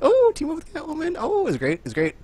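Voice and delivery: squealing with delight; high giddy gushing. Retold or imitated. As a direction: gush like an excited fan. Gushing about teaming up.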